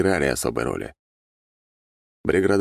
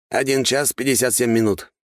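slightly raised, higher character voice; lightly voiced attendant Grigory; 7 semitones higher than usual. A narrator's reading. Grigory reports elapsed time to his master, lightly raised voice. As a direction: answer promptly in a higher voice. Figure answers the narrator's question.

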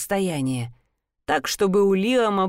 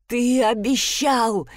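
slow, raised, breathless plea; gasping; 4 semitones higher, slower than usual. A narrator's reading. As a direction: push it out while fighting for air. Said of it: "задыхалась я".

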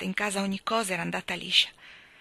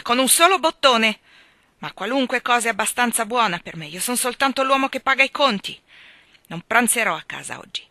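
loud, higher martyr's complaint; bitter; 4 semitones higher, 8 dB louder than usual. A narrator's reading. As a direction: play the hard-done-by breadwinner. Husband's bitter self-pity. (amaro e lamentoso)

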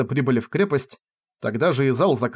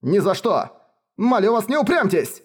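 pleading shout; 8 semitones higher than usual. Acted. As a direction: urge her, almost shouting. Advisor begs princess.

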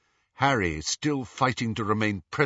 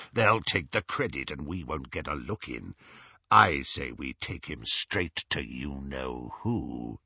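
low, growling gang leader; Greyback; 8 semitones lower than usual. A narrator's reading. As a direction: lay out the plan in a deep villain voice.

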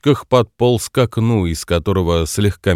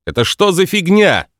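indignant shout, pitch jumping up; 9 semitones higher than usual. A narrator's reading. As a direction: blurt it out at the dark forest. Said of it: "крикнул он".

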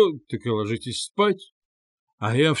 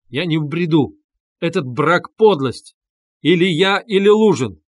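emphatic declaration building to loud; with last effort; 4 semitones higher, 5 dB louder than usual. A narrator's reading. Sick brother's ultimatum to his sister, with last effort. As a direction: summon strength, climbing to a forceful ultimatum. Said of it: "собрав последние усилия".

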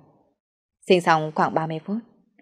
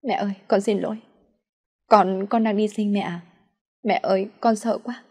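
higher, mildly tearful plea to mother; understated weepy, frightened daughter; 4 semitones higher than usual. A narrator's reading. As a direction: confess with a touch of crying. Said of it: "Tôi bật khóc nói".

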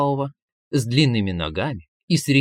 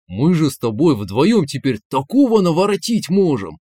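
loud, lively burst of enthusiasm; excited exclamation; 4 semitones higher, 5 dB louder than usual. A narrator's reading. Man to his new inner voice, excitedly exclaiming. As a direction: exclaim it with big excited swings. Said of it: "воскликнул он".

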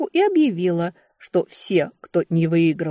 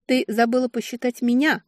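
insinuating, higher-pitched voice; 6 semitones higher than usual. A narrator's reading. Sister reminds her rivals she counts too, insinuating. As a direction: slip the reminder in coaxingly. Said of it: "вкрадчивым голосом вымолвила я".

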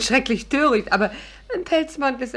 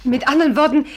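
eager excitement; 7 semitones higher, 7 dB louder than usual. Acted. Celia eagerly tempted by fur.

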